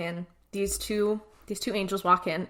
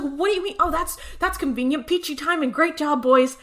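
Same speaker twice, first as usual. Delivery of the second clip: higher-pitched mock exasperation; sarcastic scolding; 6 semitones higher than usual. Ruby scolding the boys over the missing knife, sarcastic. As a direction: heap sarcastic praise on them.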